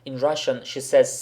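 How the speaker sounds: ordinary delivery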